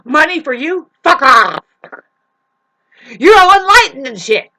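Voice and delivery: the heckler screams abuse at the master; furious shout. Acted. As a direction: blow up at the master in a rage. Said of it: "try to knock them off course and say".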